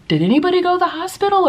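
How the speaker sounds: high, quick classroom question; high-pitched kindergarten teacher; teacher asking class. Shown as a demonstration. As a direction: ask a room of little kids. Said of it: "just by asking".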